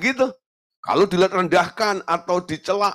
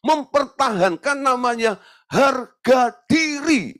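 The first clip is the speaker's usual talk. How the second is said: preach; 10 semitones higher, 3 dB louder, slower than usual. Acted. Preaching emphatically.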